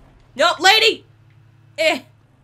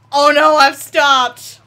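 louder, slow, drawn-out alarm; alarmed cry; 11 dB louder, slower than usual. Real, not acted. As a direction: cry it out as the train stalls.

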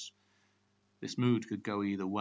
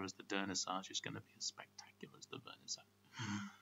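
fast, then drops to a whisper; rapid looping patter fading to whisper; faster than usual. Acted. God harangues the Marquis in a fast loop that fades to a whisper. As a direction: rattle it off quickly and let it die away to a whisper. Looping tongue-twister sentence.

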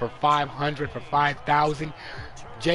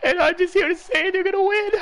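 much higher, strained put-on voice, as if forced on camera; hostage video voice; 4 dB louder than usual. Mocking a forced hostage video statement, higher put-on voice. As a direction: play a captive reading a forced statement. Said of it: "I'd say it like an Al Qaeda video".

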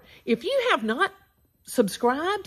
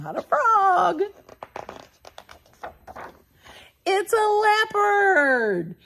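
excited reveal; 8 semitones higher than usual. Acted. Host teasing the children before revealing the leopard. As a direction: tease then reveal with delight.

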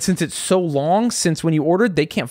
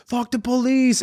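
play-acted high yell at normal volume; retold mock yell; 9 semitones higher than usual. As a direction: imitate your own yell in a high voice. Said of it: "being the one who yelled".

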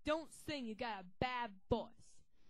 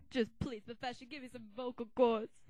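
ordinary talk

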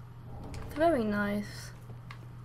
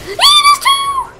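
very high, loud, drawn-out squeal; panicked squeal; 13 dB louder, slower than usual. Real, not acted. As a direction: squeal it in alarm, stretched out. Spots two enemies.

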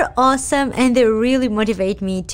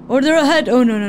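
ordinary delivery

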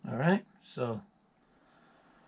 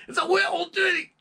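"little ditty" said high as "wee-l tetty" in a Scottish accent; Scottish falsetto impression; 6 dB louder than usual. Shown as a demonstration. Correcting the plain take with a shrill Scottish "It's a little ditty". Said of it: "Not a little ditty".

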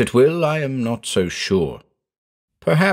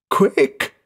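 higher-pitched urgent moan; frantic moan; 6 semitones higher than usual. A narrator's reading. Terrified man urging his friend on, frantic moan. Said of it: "he moaned".

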